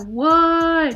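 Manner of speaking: loud, high shocked "what"; surprised. Retold or imitated. Guy spots her [name] CD, loud and surprised. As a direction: react to a total surprise.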